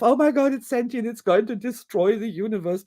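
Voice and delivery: hammed-up doomsday worry; mock alarm. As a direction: fret in mild mock dread. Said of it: "he's having the response of".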